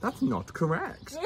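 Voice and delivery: high, slow mock correction. Correcting her own misreading, high and drawn out. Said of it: "and that's just not correct".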